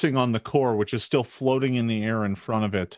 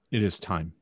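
solemn announcement; 8 semitones lower, 4 dB quieter, faster than usual. Acted. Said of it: "Golgama comes to you and says".

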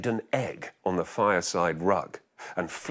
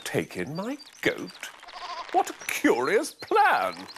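put-on high, slow puzzled old woman; old lady voice; 8 semitones higher, slower than usual. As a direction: query it like a bemused old lady.

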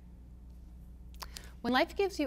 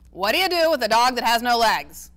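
exasperated grandpa; 2 semitones higher, 10 dB louder than usual. A narrator's reading. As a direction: throw up your hands at her. Grandpa Ace voiced in reading.

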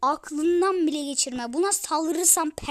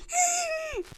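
gasp. Real, not acted. Sudden shock at full slots.

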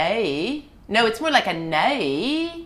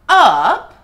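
Australian accent; 2 semitones higher, 11 dB louder than usual. Shown as a demonstration. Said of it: "it kinda goes like".

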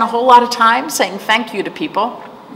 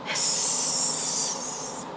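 snake hiss; 8 dB quieter than usual. Pretend. Snake in picture book.

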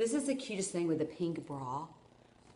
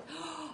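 sharp gasp of realization; quick, high intake of breath; sudden gasp; 9 semitones higher, 7 dB quieter than usual. Real, not acted. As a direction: gasp as an idea strikes. Sudden idea interrupts her talk.

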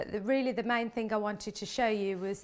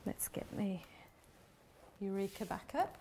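whispering; 8 dB quieter than usual. Real, not acted. Aside while fetching a tool.

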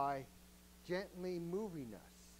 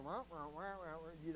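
soft muted wah wah; Charlie Brown teacher; 6 dB quieter, faster than usual. Retold or imitated. Husband tuning out his wife, mock Charlie Brown teacher. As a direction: mimic the muffled teacher's horn voice. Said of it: "it's like Charlie Brown".

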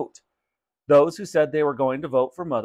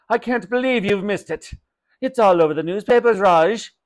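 exasperated mother, higher and louder; incredulous scolding, pitched up; 6 semitones higher, 5 dB louder than usual. Acted. Mother can't believe shopkeeper missed it, exasperated.

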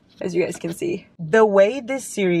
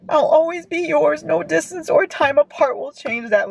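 higher, choked-up reading; real crying, pitched up; tearful reread; 8 semitones higher than usual. Real, not acted. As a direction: read it again through tears. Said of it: "I'm still crying".

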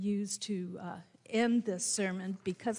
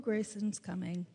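usual speaking voice

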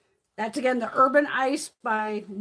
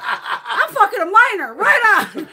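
high, loud, drawn out through laughter; retold mock celebration; 7 semitones higher, 9 dB louder, slower than usual. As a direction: re-enact a whoop, laughing as you shout. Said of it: "And I just went".

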